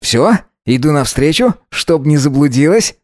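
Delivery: brisk, upbeat guy's voice; perky reassurance on the phone; cheerful young man. Acted. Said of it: "бодро проговорил Вова".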